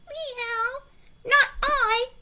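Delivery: a prissy cat saying no. A narrator's reading. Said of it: "said the cat".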